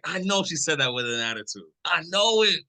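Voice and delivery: high, suspicious, sure she meant it; accusing attitude. Retold or imitated. Retelling a fight, mock-accusing his partner. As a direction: reenact catching a tone in her words. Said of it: "I'm being a little dramatic".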